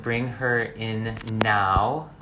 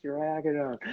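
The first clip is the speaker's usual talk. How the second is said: teasing squeak. Acted. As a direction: tease her in a squeaky voice. Teasing high voice.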